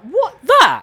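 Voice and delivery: high, loud disbelief; what, that tiny thing; incredulous. Real, not acted. Incredulous at small toy store.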